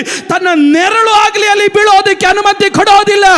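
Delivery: high, loud preaching build; fiery preaching. Acted. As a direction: preach it out to the whole hall. Sermon climax.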